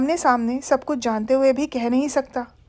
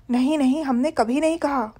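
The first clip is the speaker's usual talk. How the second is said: plain reading voice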